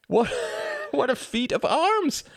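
high, slow, breathless exclamation; gleeful gasp. A narrator's reading. Tom can't stop giggling after his win, gasping triumph. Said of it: "gasped Tom".

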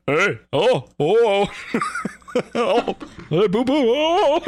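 sound effect noises again. Acted. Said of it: "make one more run at the sound".

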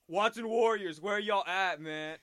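all-caps call-out. Retold or imitated. Dumb all-caps post to followers, mock call-out. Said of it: "like, all caps".

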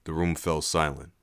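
ordinary delivery, nothing marked

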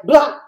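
a loud, short "Blah!" for the gun going off; mock gunshot. The hitman shooting Ron, a loud mock gunshot. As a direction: blast out the shot as one loud syllable.

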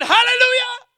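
high, loud joyful cry of Hallelujah; excited shout. Retold or imitated. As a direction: shout it out in sudden joy.

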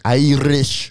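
slow, drawn-out mock brogue; exaggerated Celtic brogue; trying an Irish accent. Shown as a demonstration. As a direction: stretch the word in a put-on Irish-Scottish accent. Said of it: "Is that Irish or Scottish?".

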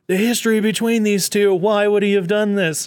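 half-playful shock at the betrayal; mock disbelief. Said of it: "it's like".

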